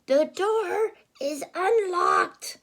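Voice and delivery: high, slow quavering granny; wolf disguised as grandma; fake granny. Wolf inviting the girl in, high fake granny.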